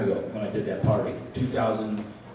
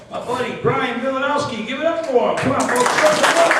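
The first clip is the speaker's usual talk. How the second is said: loud, high announcer build; rising showman introduction, louder and higher to the end; emcee hype; 11 semitones higher, 6 dB louder than usual. Real, not acted. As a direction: rev up the crowd for the next act. Hyping up the next comic.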